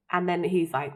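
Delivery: ordinary talk, nothing marked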